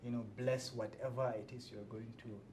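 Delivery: normal talking voice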